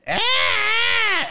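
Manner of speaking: high, loud imitated child scream; child's piercing scream; kid's scream. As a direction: shriek like a wound-up kid. Kid screaming in waiting room.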